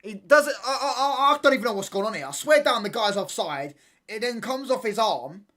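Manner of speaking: loud, high-pitched outburst of disbelief; agitated protest. Real, not acted. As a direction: protest the goal at full volume, stumbling at first. Disputed goal during live match watchalong.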